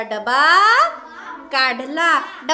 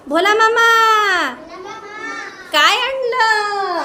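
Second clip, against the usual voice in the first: put-on Leela asking, little girl; 3 semitones higher than usual. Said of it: "लीला काय म्हणते भोला मामाला?".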